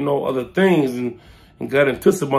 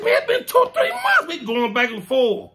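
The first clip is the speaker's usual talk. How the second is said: high arguing voice; 11 semitones higher than usual. Retold or imitated. Arguing with Omar.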